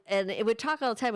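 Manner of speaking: ordinary talk, nothing marked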